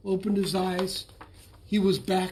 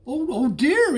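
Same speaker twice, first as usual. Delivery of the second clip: high, louder panicked cry; frightened cry; 7 semitones higher, 6 dB louder than usual. A narrator's reading. Bullfrog seeing the flood, high panicked cry. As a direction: cry out as water hits your toes. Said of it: "he cried".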